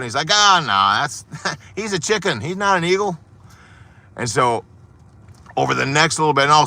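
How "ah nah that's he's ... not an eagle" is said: laughing farmer. Acted. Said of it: "like, laughing".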